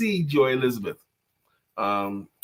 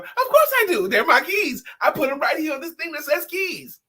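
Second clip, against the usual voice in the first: much higher, triumphant mom voice; proud mother; 12 semitones higher than usual. Retold or imitated. Mother answering her son smugly, high and triumphant. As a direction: crow it like a smug mother. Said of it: "Mom, do you know".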